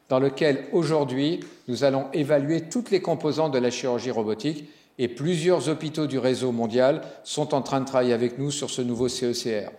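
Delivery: normal talking voice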